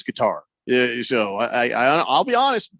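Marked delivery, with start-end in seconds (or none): 0.0-0.4 "Qatar" said kuh-TAR; "Qatar" with a long AR ending; stress on second syllable. Shown as a demonstration. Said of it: "I figured it was Qatar".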